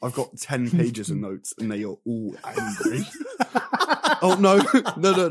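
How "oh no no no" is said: bursting into high laughter; laughing. Real, not acted. Laughing at own angry notes.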